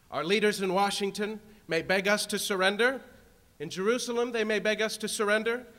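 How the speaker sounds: building to a full-throated roar; roaring rallying speech. Real, not acted.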